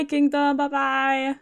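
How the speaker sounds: ordinary delivery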